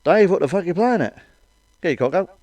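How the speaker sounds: hammed-up telling-off; nagging partner. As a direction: scold him like a fed-up girlfriend. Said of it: "what she actually said was".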